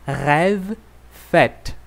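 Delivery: "rêve" slow, with an open è vowel; "fête" with a wide open e; open French ê. Shown as a demonstration. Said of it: "Rêve é um som mais aberto".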